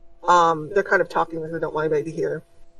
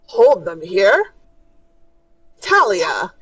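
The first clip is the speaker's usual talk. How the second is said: indignant protest; 5 dB louder, slower than usual. Acted. Doctor protests her decision.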